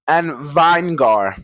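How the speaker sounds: Vinegar said slowly as "vine-gar"; mispronounced vinegar. Pretend. Co-host giving his favorite chip flavor, Vinegar mispronounced as "vine-gar". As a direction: stretch Vinegar into two beats, "VINE-gar". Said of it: "I'm not gonna say it".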